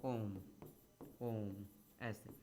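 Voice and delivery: ओम stretched very slowly and softly; drawn-out om. Shown as a demonstration. Teacher softly chants ओम to show its three beats, very slowly drawn out. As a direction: let ओम fade out long and soft, like a chant. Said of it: "इसको ऐसे हम लिख सकते हैं".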